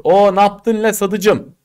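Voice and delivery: high, slow Kütahya village drawl; Kütahya dialect. Retold or imitated. Teacher imitating a Kütahya local, Kütahya dialect. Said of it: "Kütahyalılar şöyle konuşur".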